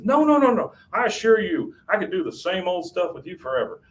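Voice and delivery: hammed-up husband scrambling to reassure; frantic protest. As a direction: Hank insisting to Peggy he isn't bored, high and urgent. Hank protests to Peggy.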